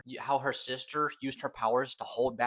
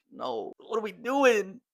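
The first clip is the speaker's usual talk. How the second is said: higher, louder, slow anguished cry; dismayed outcry; 10 semitones higher, 6 dB louder than usual. Retold or imitated. A fan reacting to MJ forgetting Peter, anguished cry. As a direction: protest in disbelief.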